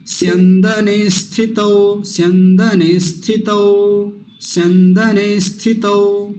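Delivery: स्यंदने स्थितौ slowly, as one phrase; said together. Shown as a demonstration. Teacher joining स्यंदने and स्थितौ. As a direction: link स्यंदने straight into स्थितौ. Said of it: "एक साथ कैसे बोला जाएगा".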